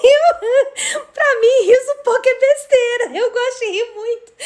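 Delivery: high-pitched, breaking into laughter; helpless amusement in the voice; laughing while talking. Real, not acted. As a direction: keep talking through real giggles. Cracks up at own story.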